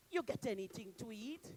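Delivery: plain reading voice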